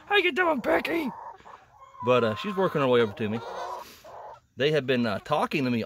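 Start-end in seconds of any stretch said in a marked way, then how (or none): 0.1-1.1 much higher, sing-song voice for the hen; high pet voice. Real, not acted. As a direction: coo it up high like talking to a pet. Greeting her hen twice.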